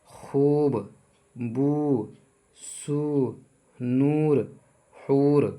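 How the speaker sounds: long, held oo in खूब, बू, सू, नूर, हूर; drawn-out vowel. Shown as a demonstration. Urdu teacher demonstrates the long waw, oo held in खूब, बू, सू, नूर, हूर. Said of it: "खींच कर पढ़ी जाती है".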